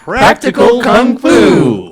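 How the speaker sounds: loud announcer title read. Acted.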